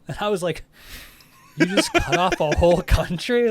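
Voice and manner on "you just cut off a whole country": very high, loud incredulous question; laughing disbelief. Retold or imitated. Worker hears Russia was dropped, laughing disbelief. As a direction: squeal it through laughter in disbelief. Retelling own disbelief at him.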